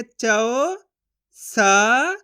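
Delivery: चौ and सा each held long and apart; sounding out syllables. Shown as a demonstration. Teacher sounding out a word letter by letter, emphatic and drawn out. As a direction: stretch each syllable, rising, with force. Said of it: "चा का उच्चारण कैसे करेंगे".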